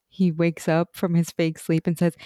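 usual speaking voice

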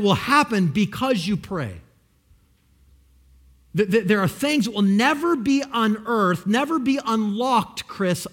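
loud, building sermon climax; rising preaching. Acted. Preacher urges the congregation to pray, loud and building. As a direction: drive it home to the congregation.

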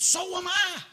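very high, excited squeal of kinship; acted burst of glee; delighted agreement. Rescuer finding common ground with jumper, high delight.

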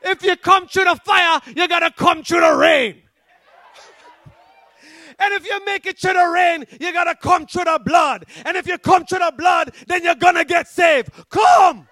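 Jamaican preacher at full force; Jamaican accent. Retold or imitated. Pastor calling the possessed man to salvation, shouted Jamaican accent. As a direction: build rhythmically to a shouted "Come" in a Jamaican accent. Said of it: "with the Jamaican accent".

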